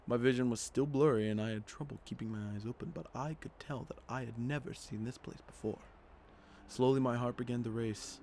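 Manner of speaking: quiet, even reading of game text; story narration. A narrator's reading. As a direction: read the story calmly to the audience. Reads the game's story text.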